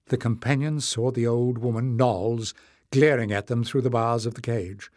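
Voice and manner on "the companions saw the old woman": usual speaking voice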